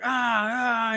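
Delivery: put-on high whiny kid cry; mock tantrum wail. Toddler on the floor wailing at his mom. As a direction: squeal like a kid losing it.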